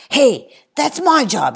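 high, loud, slow protest; indignant boy's loud shout. Acted. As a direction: yell to claim it back. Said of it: "he shouts".

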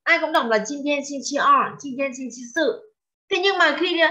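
normal talking voice

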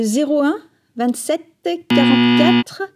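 slow dictation. Acted. Dictating phone number slowly.

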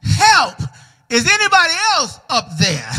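yelling for help. Acted. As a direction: shout up desperately, then hurry the question. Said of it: "Keith yelled".

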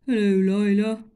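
loud, booming elephant. Acted. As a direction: boom out a greeting. Elephant speaks.

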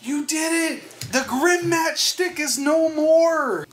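high, slow announcer-style cheer; booming victory shout. Acted. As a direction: proclaim the win like a game announcer. Victory announcement after boss win.

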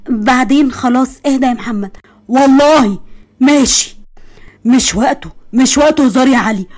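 ordinary talk

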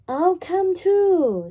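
high, loud and slow; eager hen. Acted. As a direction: chime in keenly like a hen. Hen voiced in children's story.

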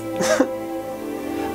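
brief high chuckle; short laugh. Real, not acted. After telling loved ones to wake up, a brief high chuckle. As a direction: laugh lightly between phrases.

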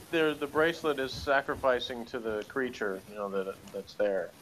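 normal talking voice

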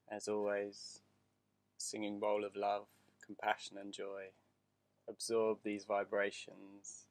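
calm, unhurried meditation voice; guided meditation. Acted. Teacher guiding listeners through breathing, meditation voice. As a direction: ease them into the breath.

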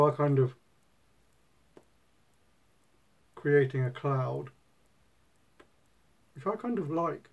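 talking around pipe stem; speaking with clenched teeth; pipe in mouth. Real, not acted. Seen 0: Pipe stem clenched in mouth from about 1s, hands free.

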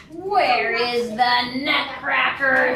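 low, slow, menacing villain voice; hissing Mouse King. A narrator's reading. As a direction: demand it slowly as the evil king of mice. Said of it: "he hissed".

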